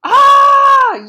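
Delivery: high, loud scream of fright; yell. Acted. Amelia Bedelia frightened at the front door, loud high yell. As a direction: let out a full startled yell. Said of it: "yelled Amelia Bedelia".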